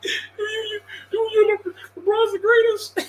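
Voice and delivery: high, stammering fake sob; mock crying fan. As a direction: sputter through fake tears defending LeBron.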